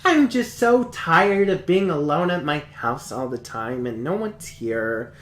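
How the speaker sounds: high, excited cutesy schoolgirl; anime girl voice. Retold or imitated. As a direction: gush like a bubbly anime heroine. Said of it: "the show starts out and it's like".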